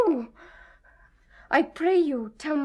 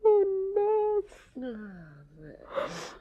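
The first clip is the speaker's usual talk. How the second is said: despairing sigh; 4 semitones higher, much slower than usual. Acted. Juliet in despair.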